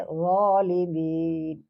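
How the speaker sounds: ظالمین slow, with a full, heavy ظ; teacher modelling the heavy letter; heavy zaalimeen. Shown as a demonstration. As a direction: round out ظالمین slowly. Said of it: "اس کو تھوڑا پر پڑھیں گے".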